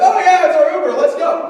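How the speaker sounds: high, loud and bubbly, laughing; a cheerful stranger sure this is her Uber; chirpy lady. Retold or imitated. Stranger lady climbing into car.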